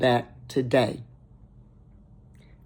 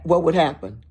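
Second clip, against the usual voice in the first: ordinary talk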